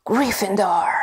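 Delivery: loud announcing call; hat's shout. Acted. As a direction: proclaim the house to the whole hall. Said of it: "hear the hat shout the last word".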